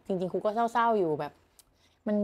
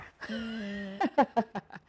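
soft run of giggles; amused giggle; 5 dB quieter than usual. Real, not acted. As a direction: giggle softly at your pet. Laughs at the sulking dog. (หัวเราะคิกคักเบาๆ)

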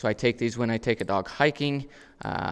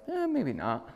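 dog's reply; 10 semitones higher, 3 dB quieter, slower than usual. Retold or imitated. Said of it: "he looked at me and was like".

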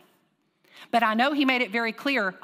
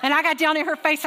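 ordinary talk

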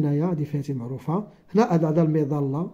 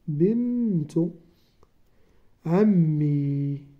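بنت عمي said slowly, with عمي stretched very long; teacher dictating بنت عمي slowly to young pupils; slow dictation; much slower than usual. Shown as a demonstration. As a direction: draw out عمي so the pupils can write it. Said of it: "تكون بنت".

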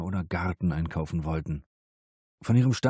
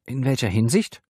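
tense; 6 semitones higher, 4 dB louder than usual. A narrator's reading. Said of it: "Ihre Stimme klang angespannt".

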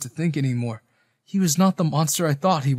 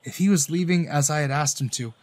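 usual speaking voice

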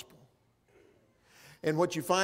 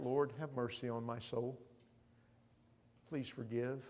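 hushed prayer; 3 semitones lower, 8 dB quieter than usual. Acted. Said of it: "we too cry out".

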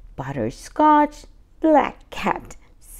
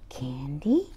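whisper; 9 semitones lower, 9 dB quieter than usual. Acted. Asking at the door.

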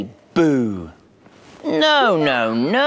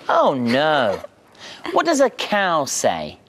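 ordinary delivery, nothing marked

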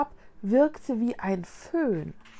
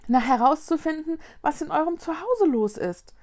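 higher, matter-of-course answer; girl explaining; 7 semitones higher than usual. Acted. Mila tells the ghost their purpose, girlish explanation.

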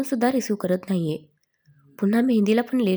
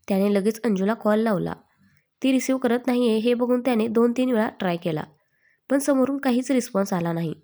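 ordinary delivery, nothing marked

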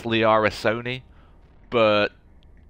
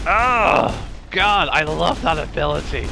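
excited; 7 semitones higher, 4 dB louder, slower than usual. Real, not acted. Excited gaming exclamation.

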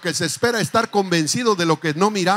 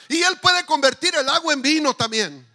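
shouting praise; 5 semitones higher, 4 dB louder than usual. Acted. Preacher raises voice again.

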